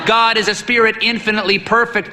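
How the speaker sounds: high, booming drill question; martial tone. A narrator's reading. Old priest quizzing the boy on the catechism, martial. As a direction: bark it like a drill sergeant.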